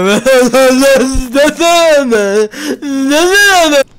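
high loud cackle; loud laugh. Real, not acted. Gamer laughing at the chaos, loud cackle. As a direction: burst out laughing.

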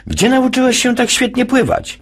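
high put-on voice for the lifeguard. Lifeguard asking Jasiu where he learned, high put-on voice.

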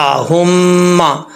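हुम्मा slowly, with the M held long; drawn-out हुम्मा; doubled M. Shown as a demonstration. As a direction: hum on the M of हुम्मा before opening to "maa". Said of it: "हुम, मीम ज़बर मा".

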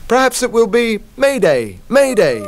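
high, loud call. Acted. Animals calling back their final reply to Malcolm, high and loud. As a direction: call it out across the harbour.

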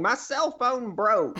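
high, drawn-out mock shout; retold shout. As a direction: wail it like someone yelling over a disaster. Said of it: "I hear someone shouting".

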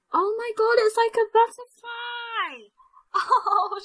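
high-pitched gushing amazement; delighted squeal. Real, not acted. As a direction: exclaim in delight, stretching the last word. Delighted at new fairy.

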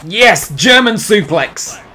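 loud, high, drawn-out triumphant shout; yelling with joy at the suplex; excited cheer. Real, not acted. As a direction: celebrate the move like a fan. Cheering his wrestler's big move.

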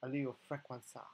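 playful mock Italian "Allele frequenza", ending bent into frenquenza; mock Italian accent. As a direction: joke Italian accent on "frequenza", lightly done. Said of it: "I don't know why this has a little accent".

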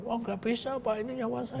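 cling to the weapon in alarm; alarmed refusal. Retold or imitated. Rebel protests handing over his gun, pitched high and pleading.

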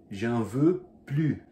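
J'en veux plus, slow and loud, plus with no S; slow loud French, plus ending on the vowel; French demo. Shown as a demonstration. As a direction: say J'en veux plus slowly and loudly, S silent. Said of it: "você pode escutar as duas versões".

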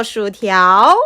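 ordinary talk, nothing marked

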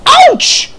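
very high, loud squeal; squeal of pain. Acted. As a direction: yelp as something jams under your wheels. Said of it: "she squealed".

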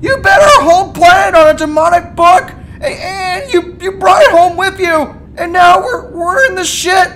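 unload on him, voice breaking; tearful rant. Acted. Morty's tearful tirade at Rick.

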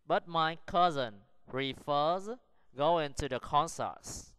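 slow, chunked reading with clear pauses; deliberate pace for learners to follow; slow model sentence. Shown as a demonstration. Teacher models sentence four for practice, slow and deliberate. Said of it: "Practice the sentences".

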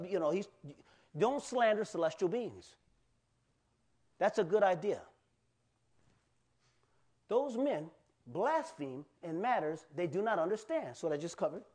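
usual speaking voice

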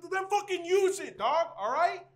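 loud, high exasperated yell; angry shout. Acted. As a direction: yell it as tough-love scolding. Berating friend in argument.

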